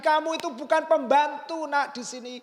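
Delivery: high, loud emphatic reassurance; father loudly insisting to his elder son; insistent father. Retold or imitated. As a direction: press it home like a father convincing his son. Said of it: "sampai harus menegaskan lagi".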